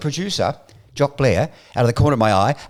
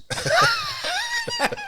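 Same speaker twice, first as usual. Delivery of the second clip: explosive laugh; 5 dB quieter than usual. Real, not acted. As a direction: crack up at the punchline. Bursts out laughing at punchline.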